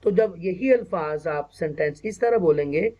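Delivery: normal talking voice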